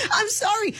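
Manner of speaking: high shrieking laugh into an apology; burst of laughter. Real, not acted. Host cracking up after calling her co-host a jerk, high laughing. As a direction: crack up at what you just said.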